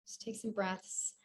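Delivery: soft, low-key breathing guidance; calm meditation voice with long, restful pauses; guided meditation. Real, not acted. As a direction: ease them into stillness, softly and unhurried.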